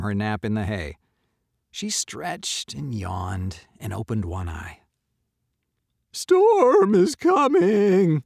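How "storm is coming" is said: loud, high, drawn-out warning; animals calling out. A narrator's reading. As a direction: call it slowly, stretched out. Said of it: "the animals told her all at once".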